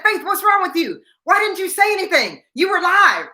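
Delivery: high-pitched, exasperated scolding; mock hollering. Producer in reporter's ear after silent live shot, mock hollering. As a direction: imitate a holler without much volume. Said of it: "then she starts hollering in my ear".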